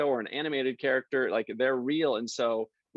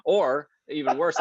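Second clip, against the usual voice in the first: ordinary talk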